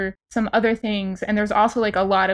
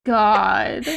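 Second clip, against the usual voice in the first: a slow, stretched oh my God; drawn-out astonished groan of disbelief; amused disbelief; 4 dB louder, much slower than usual. Real, not acted. Co-host reacting to a silly email address, slow disbelief.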